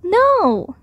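high, drawn-out protesting cry. A narrator's reading. Child objecting to Bear being washed, crying out. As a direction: cry it out high, falling away. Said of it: "I cried".

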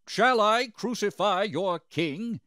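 high, loud, slow question; shouted challenge. A narrator's reading. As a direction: call it out to the mob, word by word. Pilate challenges the shouting crowd.

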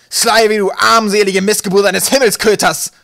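very loud, high-pitched captain's roar; furious bellow. A narrator's reading. Captain yelling for his missing mate into the night, bellowing. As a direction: roar the insult, high and drawn out. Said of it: "brüllte er in die Nacht hinaus".

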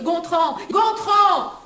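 high call, rising to a cry. Acted. Hostess hunting for Gontran, calling high.

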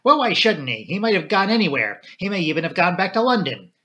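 heated, insistent protest; defiant outburst. A narrator's reading. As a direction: burst out in his defence. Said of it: "she burst out".

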